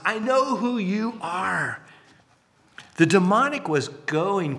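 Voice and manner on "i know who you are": high, drawn-out imitated cry. Demon-possessed man confronting Jesus, crying out. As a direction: stretch it into a high wail of recognition. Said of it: "this guy is crying out".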